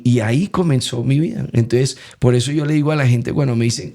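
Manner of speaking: ordinary talk, nothing marked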